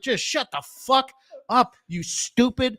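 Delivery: slow, emphatic, high-pitched exasperation; exasperated rant. Acted. Ranting at fantasy characters, slow mock exasperation. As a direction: space out the insults, utterly fed up.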